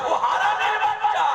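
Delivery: high, forceful declaration; commanding divine voice. Acted. Allah voiced scolding the insulter. (উঁচু গলায় জোরালো ঘোষণা)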